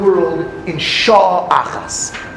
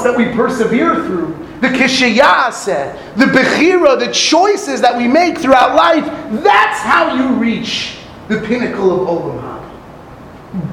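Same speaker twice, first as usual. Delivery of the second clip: normal talking voice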